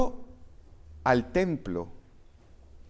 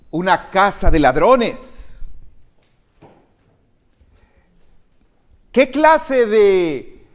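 fiery preaching; 3 semitones higher, 8 dB louder than usual. Acted. Preacher driving home the temple scene, loud and fiery. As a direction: thunder the scene at the congregation.

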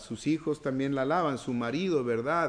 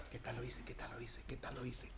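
whispered, repeating it under the breath; a nervous youth whispering for reassurance; anxious whisper; 4 semitones lower, 20 dB quieter than usual. Retold or imitated. A young man after his first talk, whispering anxiously.

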